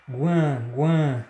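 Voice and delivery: isolated syllable "guan", nasalized, said twice; nasal diphthong. Shown as a demonstration. Teacher isolating the sound from iguana, nasal "guan". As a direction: sound out "guan" through mouth and nose. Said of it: "oral e a pronúncia nasal".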